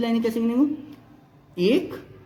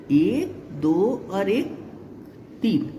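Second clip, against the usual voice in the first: slow counting; 3 semitones lower, slower than usual. Shown as a demonstration. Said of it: "slow counting for the class".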